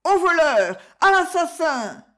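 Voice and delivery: high, loud panicked shriek for help; terrified scream. A narrator's reading.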